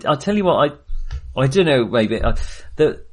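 normal talking voice

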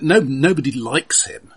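ordinary talk, nothing marked

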